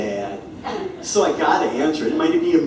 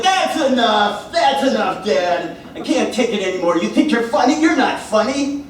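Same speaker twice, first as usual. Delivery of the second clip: higher, mock-frantic pleading; exasperated; 7 semitones higher than usual. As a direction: beg dad to stop, half laughing. Exasperated at dad's jokes.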